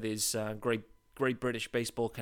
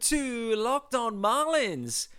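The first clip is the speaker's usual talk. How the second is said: slow, high, sing-song show opener; showy announcer welcome; 11 semitones higher, 4 dB louder, slower than usual. Acted. Host opening his daily podcast, slow showy announcer welcome. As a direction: roll out the welcome like a radio jingle.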